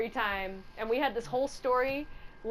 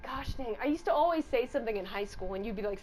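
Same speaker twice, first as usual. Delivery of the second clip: normal talking voice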